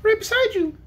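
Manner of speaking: very high squeaky piglet; tiny voice. A narrator's reading. Unseen piglet answering the wizard, tiny voice. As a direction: pipe up as a tiny little creature. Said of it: "spoke a tiny voice".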